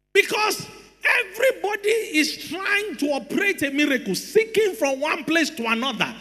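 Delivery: high-pitched, emphatic sermon delivery; preacher driving the point home; full-force preaching. Real, not acted. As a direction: preach it with urgent force to the congregation.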